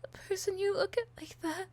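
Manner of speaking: high, loud, tearful breakdown; sobbing, begging, voice breaking high; unhinged villain. Acted.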